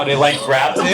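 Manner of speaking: ordinary talk, nothing marked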